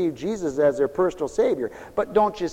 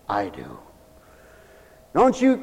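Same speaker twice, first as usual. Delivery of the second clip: normal talking voice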